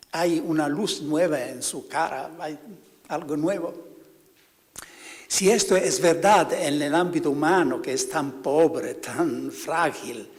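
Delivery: ordinary talk, nothing marked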